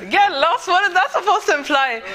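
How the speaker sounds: high, laughing disbelief at being teased; laughing surprise. Real, not acted. Teased about posh accent.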